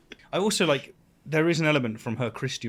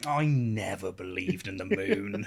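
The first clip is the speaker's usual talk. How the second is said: mock gloat, drawn out; slow hammed-up gloating through a laugh; 4 semitones lower, 4 dB quieter, slower than usual. Mocking an odd dinosaur denier, gloating. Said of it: "in a gloaty way".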